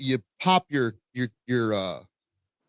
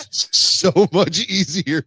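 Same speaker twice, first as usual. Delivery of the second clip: laughing; 4 semitones higher, 5 dB louder, much slower than usual. Real, not acted. Cracking up mid-sentence.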